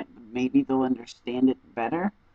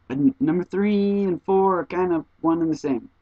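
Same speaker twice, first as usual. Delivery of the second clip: ordinary talk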